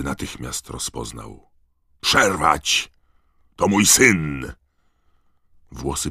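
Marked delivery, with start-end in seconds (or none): 2.0-4.5 loud, raised desperate command; father's urgent bellow; roared order. A narrator's reading. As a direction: roar to stop the attack. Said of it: "ryknął głos".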